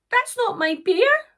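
high-pitched, protesting little voice; indignant Sarah. Acted. Sarah telling the pony it's the wrong bear, indignant. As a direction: object in a high voice.

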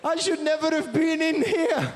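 put-on very high, whiny Greek accent; high self-pitying Greek accent. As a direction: moan it, slumped in the corner. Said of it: "the Greek accent".

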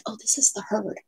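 hushed whisper so the deer stay. Real, not acted. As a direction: breathe it without voice, not to scare them. Deer in the yard nearby.

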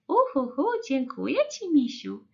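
high, appreciative teacher voice; chirpy grateful nursery teacher; pani Łosia. A narrator's reading. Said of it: "powiedziała pani Łosia".

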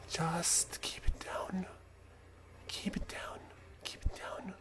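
slow unvoiced whisper, repeated. Real, not acted. Gamer sneaking past guards, hushing himself in a whisper. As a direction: breathe it so the guards can't hear. Creeping past enemies in stealth.

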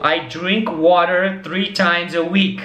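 slow, clearly spaced words; slow example sentence. Shown as a demonstration. Teacher gives students a slow, clear example sentence. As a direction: model it slowly for beginners. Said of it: "Então, por exemplo".

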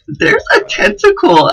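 shaking, freaked out. Real, not acted. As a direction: squeal at the tentacle in shock. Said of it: "I'm shaking".

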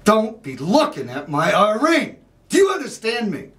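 loud, high, threatening shout; angry confrontation. Acted. Jealous man confronting a guy in the bathroom, shouting angrily. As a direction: yell it as a furious warning. Confronts rival in acted monologue.

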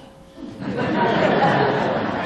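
high, loud laughter at his own joke; real loud laugh. Real, not acted. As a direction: burst out laughing at the made-up line. Laughing at own made-up line.

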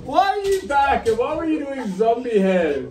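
high, amused surprise; surprised. Real, not acted. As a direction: react in amused disbelief. Mother surprised by child.

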